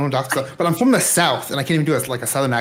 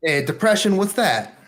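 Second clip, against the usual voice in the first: New York quote; 7 semitones higher than usual. Shown as a demonstration. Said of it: "I can only do quotes".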